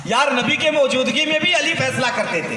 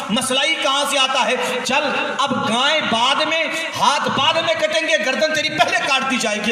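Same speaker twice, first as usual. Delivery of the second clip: stern threat; 4 semitones higher than usual. Retold or imitated. Ali threatens the guilty man.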